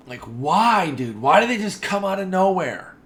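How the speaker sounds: loud, high-pitched wail of frustration; loud pleading protest, swooping high; exasperated outburst. Real, not acted. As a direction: cry out in exasperation. Exasperated at hiccups.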